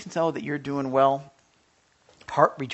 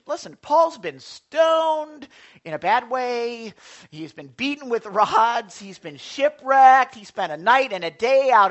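emphatic preaching; 6 semitones higher, 10 dB louder, slower than usual. Real, not acted. Pastor lists Paul's sufferings.

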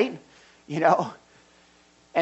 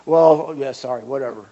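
loud "well" sinking into a low shrug; dismissive kid; 5 semitones lower, 8 dB louder than usual. Acted. Kid told the apology seems false, dismissive. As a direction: brush the parent off carelessly. Acted kid brushing off parent.

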